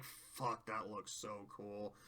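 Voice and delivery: soft, awed low murmur under the breath; awed hush. Real, not acted. Reacting to the melting stained glass, awed hush. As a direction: let the wonder hush you. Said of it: "I'm like".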